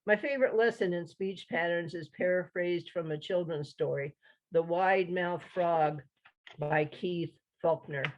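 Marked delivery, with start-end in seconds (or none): none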